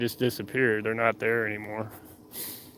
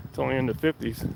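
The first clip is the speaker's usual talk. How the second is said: ordinary talk, nothing marked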